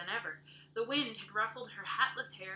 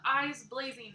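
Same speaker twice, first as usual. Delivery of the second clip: plain reading voice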